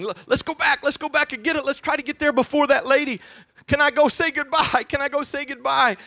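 put-on high, loud, pleading child's wail; imitating weeping child. Father imitates his weeping daughter begging to go back. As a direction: beg like a sobbing ten-year-old girl. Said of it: "As she was weeping".

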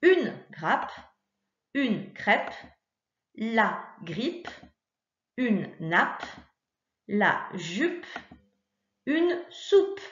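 spaced words with a pause after each; teacher reading the corrected words slowly; reading the answers. Shown as a demonstration. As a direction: say each answer clearly to a young pupil. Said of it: "ça donne ceci".

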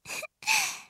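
short high giggle; shy giggle. Acted. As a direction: giggle shyly. Happy giggle after confession.

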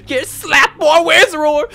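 put-on high, slow scolding old woman; stern grandma. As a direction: threaten with the ruler. Grandma threatening to slap.

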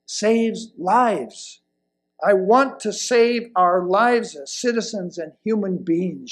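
ordinary talk

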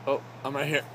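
normal talking voice

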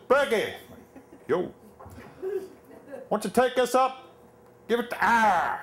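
Pennsylvania Dutch shout. Retold or imitated. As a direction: shout it at the new guy in a thick Pennsylvania Dutch accent. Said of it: "they talk funny and they shout".

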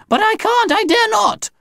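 very high, panicky protest; high alien wail of dread; frightened refusal. Acted. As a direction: plead in terror, pitched right up. Alien aunt refuses in fear.

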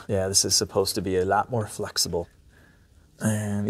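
usual speaking voice

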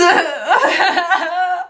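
high laugh tipping into crying. Real, not acted. Peeling latex off her eyebrows, a laugh close to tears. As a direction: laugh as if about to cry.

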